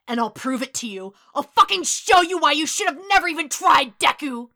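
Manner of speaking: loud, climbing angry shout; threatening, full-force rage; furious threat. A narrator's reading. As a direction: build into an enraged yell. Enraged boy threatening the other.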